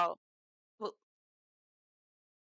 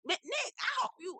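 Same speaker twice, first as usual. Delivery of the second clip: ordinary delivery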